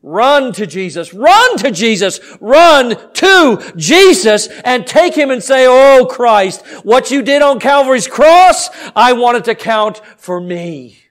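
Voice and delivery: ringing sermon appeal, hard on key words; full-force preaching. Real, not acted. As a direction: preach it urgently to the congregation.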